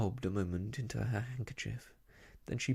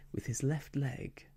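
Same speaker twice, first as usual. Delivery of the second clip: plain reading voice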